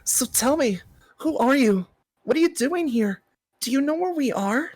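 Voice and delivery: high, questioning hero voice; curious hero. Acted. Hero questioning the stranger in a high curious voice. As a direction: press him with high eager questions.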